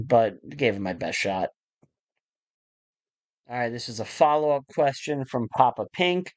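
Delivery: ordinary talk, nothing marked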